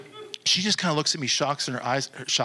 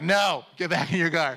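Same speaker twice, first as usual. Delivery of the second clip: raised-pitch comic dismissal; mock rebuff; 5 semitones higher than usual. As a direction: shoo them off jokingly, half laughing. Joking rebuff to a stranger.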